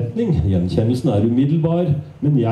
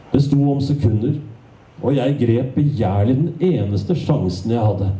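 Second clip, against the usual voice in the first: usual speaking voice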